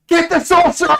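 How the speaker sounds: high, loud furious guard; guard hit by a firebolt, shouting in pain; burned guard yelling. Acted. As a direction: scream orders while on fire. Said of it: "and he's like".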